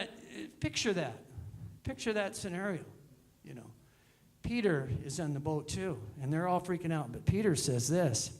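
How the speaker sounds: ordinary delivery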